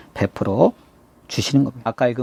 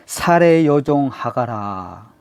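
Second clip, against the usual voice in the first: slow, drawn-out call by name; angel calling out; much slower than usual. Acted. God's messenger calling Hagar, a slow summons. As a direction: stretch each word as a solemn summons. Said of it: "그래서 뭐라 그래요?".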